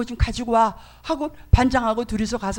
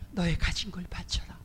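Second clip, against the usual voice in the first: God whispering the command; whispered voice of God; 4 semitones lower, 9 dB quieter than usual. Retold or imitated. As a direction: breathe the command like an inner voice. God's inner voice again.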